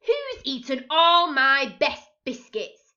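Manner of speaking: furious about his biscuits; angry captain. A narrator's reading. Captain demands who ate his biscuits, loud angry.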